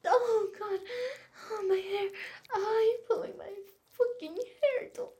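high, loud cries of pleasure; loud moaning cries. Acted. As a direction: let it burst out in loud, high moans. Hair pulled during sex.

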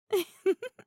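high giggle with a rise; giggle, half daring him; playful high laugh; nervous playful giggle. Acted. Laughs at coming revenge.

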